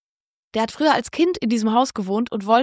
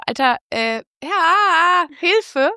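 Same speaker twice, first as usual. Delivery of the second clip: retold shout for help; 6 semitones higher, much slower than usual. Retelling how she yelled to her boyfriend, mock shout. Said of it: "hab nach meinem Freund geschrien".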